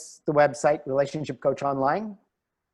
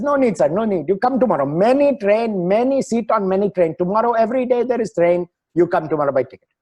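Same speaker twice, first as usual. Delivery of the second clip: lightly imitated Indian English ticket clerk, higher; a hint of an Indian clerk's accent, insistent and a bit higher; 6 semitones higher, 4 dB louder than usual.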